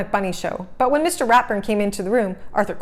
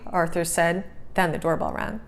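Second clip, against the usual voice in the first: ordinary delivery, nothing marked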